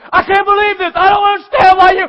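high, loud ranting; mock angry rant. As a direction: blow up in a fit of anger. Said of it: "find that put on nowhere".

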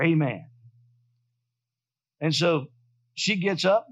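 ordinary talk

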